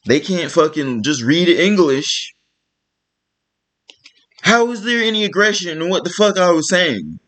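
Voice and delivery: ordinary delivery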